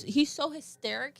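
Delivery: usual speaking voice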